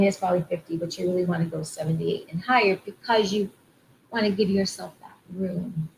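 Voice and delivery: normal talking voice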